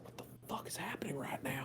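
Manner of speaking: baffled hushed whisper; bewildered whisper. Acted. Holb whispers, baffled, sucking his bitten finger. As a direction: whisper in disbelief.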